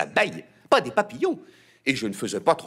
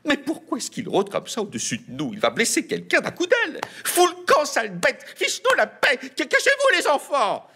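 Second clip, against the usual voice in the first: very high, panicked shrieking; high frantic mother yelling at a bird; lamenting mother; 3 dB louder than usual. A narrator's reading. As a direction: shoo the pest in a high panic. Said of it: "se lamentait Azalée".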